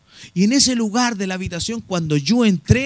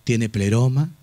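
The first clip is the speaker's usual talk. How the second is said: preaching; 9 semitones lower than usual. Real, not acted. Low earnest preaching.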